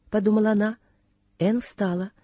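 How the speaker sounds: ordinary delivery, nothing marked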